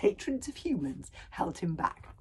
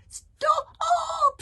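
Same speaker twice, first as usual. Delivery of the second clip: much higher, piercing dragon shriek; 6 dB louder than usual. A narrator's reading. Toothless begging Hiccup to stop, shrieking. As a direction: shriek in panic. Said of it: "shrieked Toothless".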